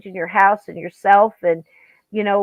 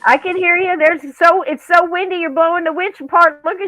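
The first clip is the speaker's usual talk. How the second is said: raised high voice over wind noise; shouting over wind; 9 semitones higher, 4 dB louder than usual. Real, not acted. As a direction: call out over the storm.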